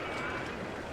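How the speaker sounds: breathless airy chuckle; small amused breath laugh; soft laugh. Real, not acted. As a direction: let out a quiet voiceless laugh. Laughs after reading ride stats.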